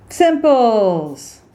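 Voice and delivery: high, loud meerkat squeak; catchphrase. Acted. Coach signing off with a squeaky catchphrase. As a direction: chirp it like a cartoon meerkat. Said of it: "catchphrase sign-off".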